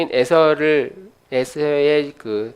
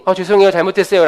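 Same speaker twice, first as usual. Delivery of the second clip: 빠른 변명조; 4 semitones higher, 5 dB louder, faster than usual. Retold or imitated. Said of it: "라고 막 말이 나올 만큼".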